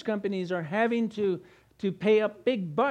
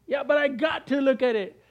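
phone-addicted youth; 6 semitones higher, 4 dB louder than usual. Acted. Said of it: "See how addicted we are to ourselves?".

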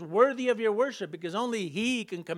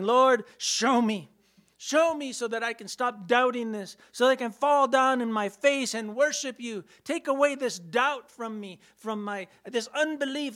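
higher, fervent plea to God; pleading prayer; 4 semitones higher, 5 dB louder than usual. Acted. Doubter asking the Lord to show himself, pleading. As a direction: cry out in prayer, drawing out the first words.

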